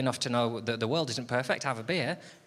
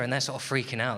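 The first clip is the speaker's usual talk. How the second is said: normal talking voice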